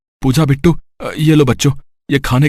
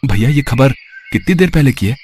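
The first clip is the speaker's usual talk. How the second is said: plain reading voice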